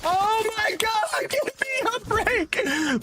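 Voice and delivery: drawn-out, very high exasperated wail. Real, not acted. Gamer pleading for relief mid-fight, high exasperated wail. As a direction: stretch it out high, begging for a break.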